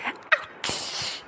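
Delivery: a soft breathy mock yelp of pain; whispered ouch. Mother pretending a jellyfish sting for baby, mock whispered ouch.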